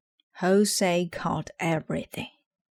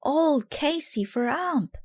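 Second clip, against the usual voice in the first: team cheer; 10 semitones higher than usual. A narrator's reading. Team chanting for Casey.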